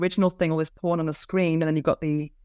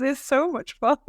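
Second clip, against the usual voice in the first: ordinary talk, nothing marked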